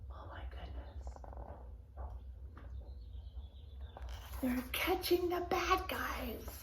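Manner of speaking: breathless hushed whisper; excited whisper. Real, not acted. Reader whispering to listening children, hushed. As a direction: whisper it like watching a secret.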